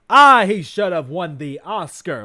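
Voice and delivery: a hint of a mocking John Mulaney; lightly voiced mocking impression. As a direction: mimic his storytelling smugly. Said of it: "John Mulaney shtick".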